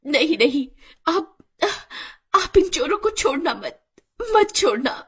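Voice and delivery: high, broken, halting gasps; mortally wounded wife struggling to speak; dying plea. Acted. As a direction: plead with your last breaths. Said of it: "ये कहकर गीता ने दम तोड़ दिया".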